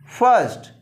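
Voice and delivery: ordinary delivery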